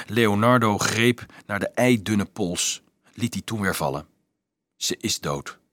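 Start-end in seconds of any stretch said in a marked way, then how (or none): 4.8-5.6 put-on softly and low, grieving over the dead child; sad. A narrator's reading. Leonardo tells Dolf the girl has died, sadly. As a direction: let the news fall quietly and sadly. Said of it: "zei hij droevig".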